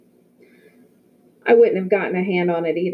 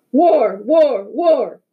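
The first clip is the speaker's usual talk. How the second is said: loud, higher, punchy repeated cries; frenzied cry; 5 semitones higher, 4 dB louder, much slower than usual. A narrator's reading. The team turns the chant into a frenzied cry. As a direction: whip the huddle into a frenzy. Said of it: "the frenzied cry of".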